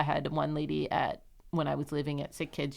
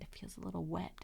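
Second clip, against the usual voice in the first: put-on softly, not to wake the baby; hushed; 2 semitones higher, 12 dB quieter than usual. Mother quietly tells the night nurse, hushed. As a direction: keep it down, near a whisper.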